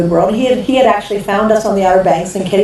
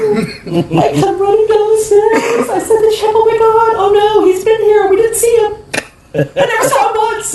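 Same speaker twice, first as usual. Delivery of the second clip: laughing panic; 12 semitones higher than usual. Retold or imitated. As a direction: squeal it out in amused horror. Panic after critic revealed.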